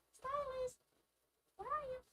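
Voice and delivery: sing-song calling in a high voice; playful villain taunt. Pretend. Calling to lost stylus, hide-and-seek.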